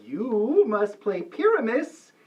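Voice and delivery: ordinary talk, nothing marked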